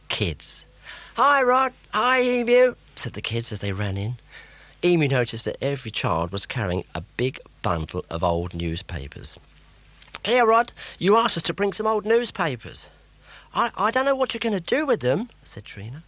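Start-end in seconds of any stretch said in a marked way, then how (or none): 1.1-2.7 put-on high, loud children's greeting; cheerful kids. 10.2-15.3 put-on high, loud children's greeting; cheerful kids. Kids speak.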